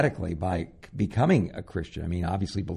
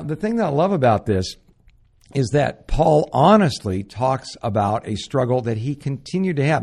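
normal talking voice

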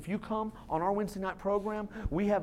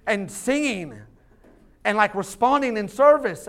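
loud, energetic sermon drive; rally the church; building pulpit energy; preaching at full force; 2 semitones higher, 7 dB louder than usual. Acted.

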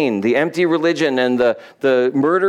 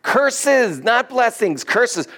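high-pitched acted exasperation; exasperated correction; 9 semitones higher, much slower than usual. King correcting his hired soothsayer, high exasperated protest. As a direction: protest high, hammering each word. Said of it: "And Balak says".

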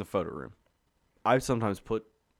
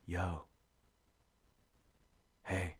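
low, soft call to a sleeper; tentative quiet call; 4 semitones lower, 10 dB quieter than usual. Retold or imitated. Retelling a visit to a sleeping coworker, hushed call. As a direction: softly check whether he's awake.

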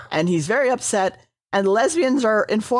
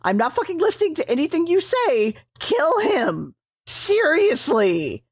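angry command; 7 semitones higher than usual. Retold or imitated.

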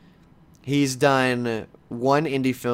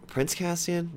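doubtful self-check; 5 semitones higher, 7 dB quieter, slower than usual. Real, not acted. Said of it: "Did I just fucking say that?".

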